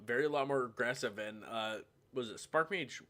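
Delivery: ordinary talk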